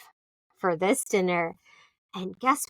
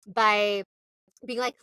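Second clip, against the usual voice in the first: ordinary delivery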